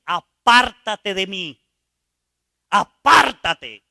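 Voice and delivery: loud and slow, each word pushed away; a pleading shout to be left alone; desperate rejection. Retold or imitated. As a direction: cry out apártate in shame. Dying man rejects God.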